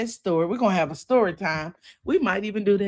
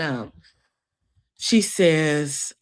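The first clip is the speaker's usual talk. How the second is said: normal talking voice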